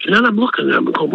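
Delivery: normal talking voice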